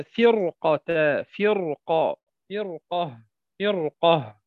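فرقة with a thick ra, first very slow; heavy ra. Shown as a demonstration. Teacher drills the word for students, heavy ra. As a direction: repeat فرقة, ra heavy each time. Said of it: "مثال قوله تعالى".

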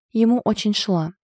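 plain reading voice

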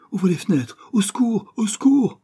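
loud, very high shouts, climbing; escalating high-pitched panic; panicked cry for help. Acted. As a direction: call desperately for help.

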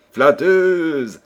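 loud, high teasing exclamation; playful reproach. A narrator's reading. King to his flattering daughter, playfully chiding. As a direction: tease her fondly, loud.

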